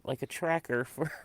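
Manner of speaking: hushed unvoiced whisper, laughing at the end. Real, not acted. Whispering the tracker tip to her cohost. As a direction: lean in and whisper it like a secret.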